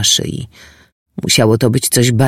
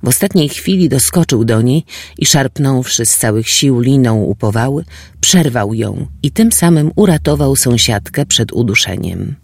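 usual speaking voice

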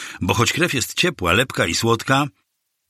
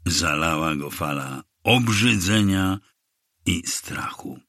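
plain reading voice

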